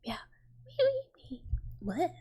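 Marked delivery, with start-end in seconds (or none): 0.6-1.4 high, falling cat-like squeals; high voiced kitty cries, sliding down; little cat noises. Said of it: "I love a fake little cat noise".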